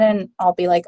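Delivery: ordinary delivery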